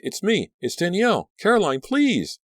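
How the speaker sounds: very high, frantic pleading; terrified shriek. A narrator's reading. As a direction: scream to be recognised. Shrieking at werewolf.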